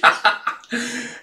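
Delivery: high, soft giggle; light breathy titter; amused giggle. Real, not acted. Vlogger amused by the weaklings line, giggling.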